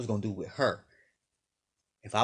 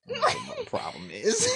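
mock high voice; 5 dB louder than usual. Mocking the girlfriend's mother.

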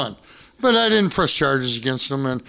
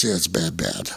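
grim doctor voice; 10 semitones lower, 6 dB quieter than usual. Retold or imitated. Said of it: "And he goes".

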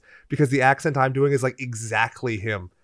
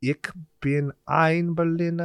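put-on slow German-accented line; 6 semitones higher, slower than usual. Showing off his German accent, slow. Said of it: "that's what that accent is".

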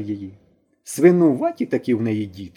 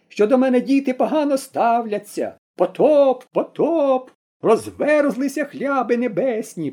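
high, slow doom-laden proclamation; prophesying old woman; 9 semitones higher, slower than usual. A narrator's reading. Granny proclaims divine punishment to everyone, forceful and high. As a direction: thunder the flood prophecy like a preacher. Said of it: "голос її набрав сили й металу".

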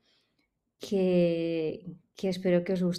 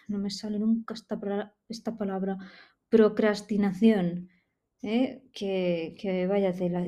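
ordinary talk

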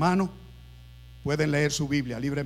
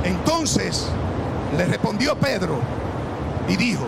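preaching at full force; 5 semitones higher than usual. Acted. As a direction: preach the storm story to the congregation. Preached narration.

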